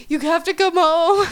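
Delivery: whiny fake sob, high-pitched; mock crying. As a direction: whimper it like a meltdown. Crying call to roommate Chloe.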